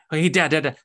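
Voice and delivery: a hint of an eager son calling out; calling out to dad. Son calling to his returning father, mild calling out.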